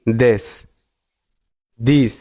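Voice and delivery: each "this" begun with a Bengali দ. Shown as a demonstration. Teacher modelling pronouns, th said as d in "this". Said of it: "উচ্চারণ করতে হবে দয়ের মতো".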